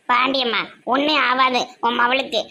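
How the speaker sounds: ordinary talk, nothing marked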